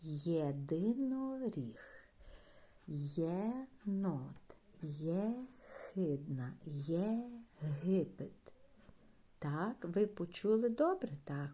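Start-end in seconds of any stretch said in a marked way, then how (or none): 0.0-8.3 each word drawn out slowly, first sound Є stressed; drawn-out teacher's model reading; slow clear demo. Shown as a demonstration. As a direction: say Єдиноріг, єнот, єхидна, Єгипет slowly and clearly for children. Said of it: "вимовимо з вами ще раз ці слова виразно".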